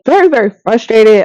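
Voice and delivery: ordinary talk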